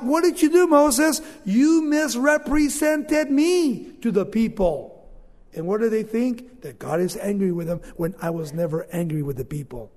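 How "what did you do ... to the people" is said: high, loud scolding rebuke; God rebuking Moses, loud and high. Acted. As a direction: call him out loud and high, then slow the last line.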